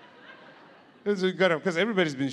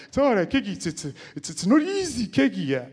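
Ronnie Aptieka; 6 semitones higher, 4 dB louder than usual. Retold or imitated. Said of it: "he speaks in a strong accent".

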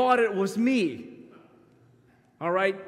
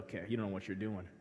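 muttered aside; 11 semitones lower, 12 dB quieter, faster than usual. Acted. Aside on bad players.

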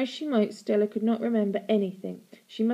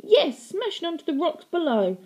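high, loud gleeful relish; 9 semitones higher, 6 dB louder than usual. A narrator's reading. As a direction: savour the bad news. Relishing aunt.

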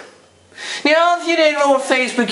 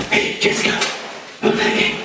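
voiceless rasping whisper; hushed voiceless horror-style call; scream voice; 7 semitones higher, 3 dB quieter than usual. Shown as a demonstration. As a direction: whisper it like a creepy horror-film voice. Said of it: "I like the scream voice".

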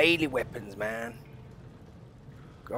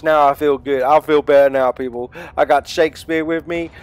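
normal talking voice

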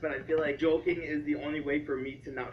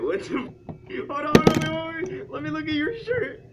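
startled; 12 semitones higher, 6 dB louder than usual. Real, not acted. Startled by shirt, loud high.

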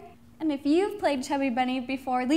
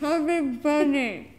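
slow, mouth stuffed with marshmallows; mouth full; 5 dB louder, slower than usual. Real, not acted. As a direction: force it out through full cheeks.

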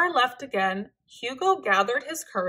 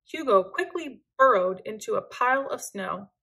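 plain reading voice

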